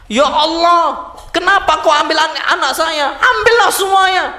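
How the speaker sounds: hammed-up wail rising to a shout; acted anguished outcry. Acting a bereaved person's outcry.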